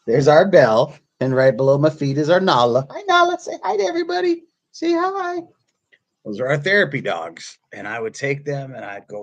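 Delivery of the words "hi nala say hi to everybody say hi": very high-pitched doggy voice; pet talk. Real, not acted. Speaker getting dog Nala to greet viewers, pet talk. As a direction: coax the dog in a squeaky singsong.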